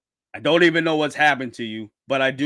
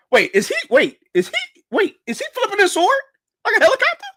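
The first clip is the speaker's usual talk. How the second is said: high, rising, can't believe it; amazed disbelief; 5 dB louder, faster than usual. Real, not acted. Streamer to viewers at a sword trick, amazed disbelief. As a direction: pitch shooting up in disbelief.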